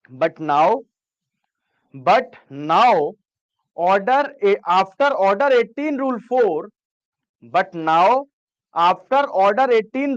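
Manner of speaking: each phrase drawn out very slowly; slow classroom dictation. Shown as a demonstration. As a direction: read it out slowly for note-taking. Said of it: "कभी-कभी बहुत slow हो जाता है, slow बोलता हूँ".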